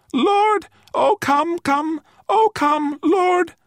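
tiny, very high creature voice, urgent; thin pleading cry. A narrator's reading. Little creature begging the man to follow, crying thinly. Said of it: "it cried thinly".

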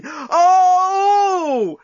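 long high climactic wail; ecstatic cry. A narrator's reading. Journalist climaxing as the clock runs out, crying out. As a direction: let out a sustained cry. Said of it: "cried the journalist".

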